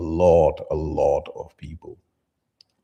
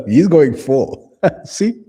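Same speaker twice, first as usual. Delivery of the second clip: laughing; 9 semitones higher, 11 dB louder, much slower than usual. Real, not acted. Reacts gleefully to clip.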